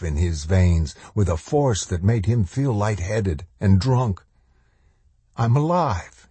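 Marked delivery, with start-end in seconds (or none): 5.4-6.3 exhilarated thought. A narrator's reading. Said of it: "he thought".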